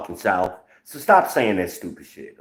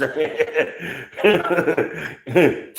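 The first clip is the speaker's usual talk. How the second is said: amused laugh; 8 semitones higher than usual. Real, not acted. Laughing at own miscount.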